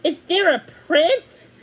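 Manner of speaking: bursting with curiosity; excited girl asking; eager. Acted. Thumbelina eager question.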